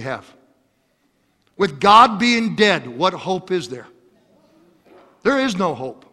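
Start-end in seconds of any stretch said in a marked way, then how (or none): none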